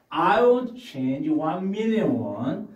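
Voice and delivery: slow, careful English sentence; teacher demo read; slow model reading. Shown as a demonstration. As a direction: read it slowly for learners. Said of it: "먼저 제가 한번 읽고".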